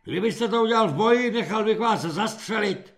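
angry snap. A narrator's reading. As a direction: snap at the recruits. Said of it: "osobl se na ně Halík".

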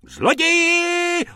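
very high, long, loud scream; piercing alarmed cry, last vowel held; shriek. A narrator's reading. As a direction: screech it across the street. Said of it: "rozječila se paní z protější trafiky".

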